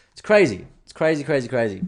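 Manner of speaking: loud, high exasperated cry of crazy; ranting it's crazy at the top of his voice; exasperated outburst. Real, not acted. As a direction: throw your hands up and shout it. Exasperated rant about outrage.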